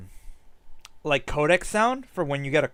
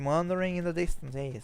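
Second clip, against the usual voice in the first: ordinary talk, nothing marked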